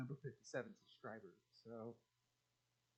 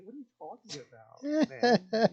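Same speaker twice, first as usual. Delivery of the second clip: mock baffled yell; 8 semitones higher, 14 dB louder than usual. Retelling his reply to a friend, a mock baffled yell. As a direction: voice jumps up loud on man.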